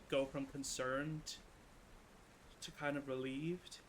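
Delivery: ordinary talk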